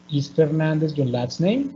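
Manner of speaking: level, then "name" lifts up; rising yes-no question. Shown as a demonstration. Teacher reads a dialogue question, rising on "name". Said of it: "So at the end is rising".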